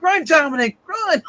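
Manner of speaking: high, loud mock panic shout; urgent yell. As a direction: yell it like a frantic warning. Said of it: "And I'm like".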